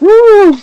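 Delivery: high, loud trumpeting elephant call. Shown as a demonstration. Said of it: "that was my ele-, ele-, elephant impression".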